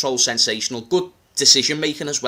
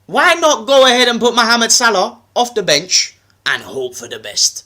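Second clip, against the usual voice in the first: emphatic, higher-pitched London patter; London accent; 3 semitones higher, 3 dB louder than usual. Acted. Making his case to Matisse, in a London accent. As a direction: argue the case in street London. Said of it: "I'll do it in my London accent".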